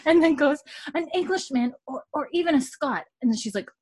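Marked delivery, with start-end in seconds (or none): 1.0-3.0 put-on high, earnest young girl; high girlish voice. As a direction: say it high and naive. Mary's high voiced line.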